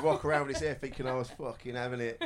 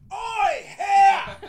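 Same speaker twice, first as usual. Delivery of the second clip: loud, high street heckle; mimicked hostile holler; aggressive shout; 14 dB louder than usual. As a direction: yell it like an accusation. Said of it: "This sounded different".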